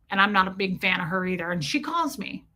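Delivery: ordinary talk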